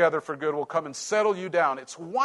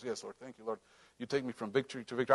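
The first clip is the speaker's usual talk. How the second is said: ordinary delivery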